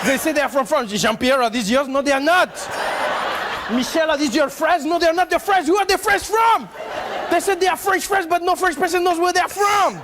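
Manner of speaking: increasingly high, frantic mock French accent; hammed-up French accent, outraged interrogation; passionate French accent. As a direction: build to an exasperated French-accented cry. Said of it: "said that with passion".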